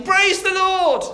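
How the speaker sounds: high, loud, drawn-out praise; shouting. Acted. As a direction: shout it slowly to the whole street. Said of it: "she carried on shouting".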